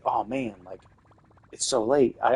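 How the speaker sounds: normal talking voice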